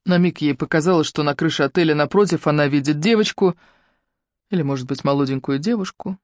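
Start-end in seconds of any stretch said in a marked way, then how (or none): none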